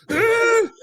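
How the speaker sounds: a high mock scream like fleeing bees; hammed-up panicked shriek; Tommy Boy scream. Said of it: "just like Tommy Boy".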